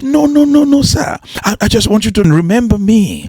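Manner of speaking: high, rushed no no no; urgent protest. Acted. Grown boy to crying teacher, urgent high protest. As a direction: stop him from apologising.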